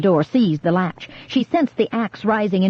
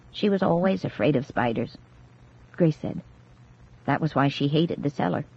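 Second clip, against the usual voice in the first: quiet explanation; 3 semitones lower, 6 dB quieter than usual. A narrator's reading.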